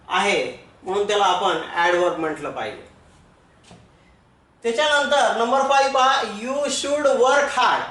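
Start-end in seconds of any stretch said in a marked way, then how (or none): 6.2-7.8 very slow, word by word; dictating an English example sentence to a class; slow dictation. Shown as a demonstration. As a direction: read it out slowly for students to copy.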